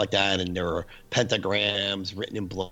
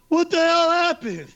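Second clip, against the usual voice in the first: loud, high, drawn-out yell; retold incredulous shriek; acted panicked shout; 7 dB louder, slower than usual. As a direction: demand it in mock hysteria.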